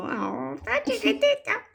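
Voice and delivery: voz aguda y tierna de personaje chiquito; tierno personaje. Retold or imitated. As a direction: imita con voz finita a algo adorable. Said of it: "y él es tan como". (voz aguda y dulce de personaje tierno)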